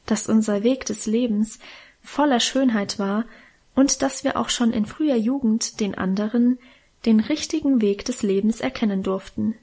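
ordinary delivery, nothing marked

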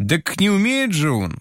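high indignant outburst; a boy bristling in protest; flaring up. A narrator's reading. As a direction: blurt it out hotly, pitch jumping up. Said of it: "вспыхнул Толик".

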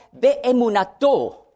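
"Beemunato" slowly, long ee, be-e-mu-NA-to; Hebrew word. Shown as a demonstration. Preacher teaching the second Hebrew word, careful Hebrew. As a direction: say "Beemunato" syllable by syllable. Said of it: "Das zweite Wort".

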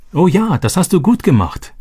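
bright, high and happy; delighted. A narrator's reading. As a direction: beam with joy at him. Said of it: "freute sich Robby".

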